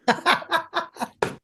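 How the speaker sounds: high burst of real laughter. Real, not acted. Reacting to Joe's joke.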